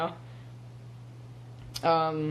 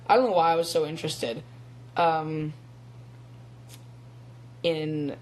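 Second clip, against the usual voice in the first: usual speaking voice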